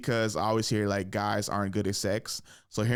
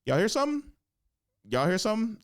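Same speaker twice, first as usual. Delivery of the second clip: mock ignoring; 10 semitones higher, faster than usual. Pretending not to hear ex.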